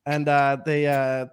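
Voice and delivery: ordinary talk, nothing marked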